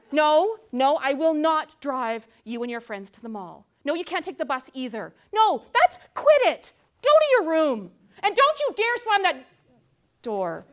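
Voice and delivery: loud, high mock yelling at teen; angry parent. Parent refusing teen and sending her off, yelling. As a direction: send the teen to the room.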